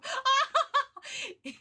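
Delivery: high burst of giggles; laugh. Real, not acted. Streamer delighted by courtroom crossover, laughing high. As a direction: crack up in a high cackle.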